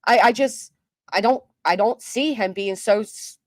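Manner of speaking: ordinary talk, nothing marked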